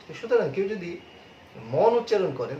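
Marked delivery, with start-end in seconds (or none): 1.7-1.9 মন with open "aw", "mawn"; louder, mistaken "mawn" for মন; wrong pronunciation. Shown as a demonstration. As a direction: stress মন with a wide "aw" vowel. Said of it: "তিনি ভুল উচ্চারণ করছেন".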